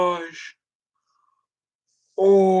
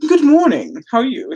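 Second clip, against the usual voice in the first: high, loud, hammed-up posh greeting; 7 semitones higher, 5 dB louder than usual. Cat wearing the collar says nice phrases, very posh. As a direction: greet nicely in a mock posh accent. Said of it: "come out with very posh, like, nice, like, phrases".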